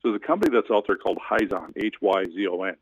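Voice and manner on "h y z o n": normal talking voice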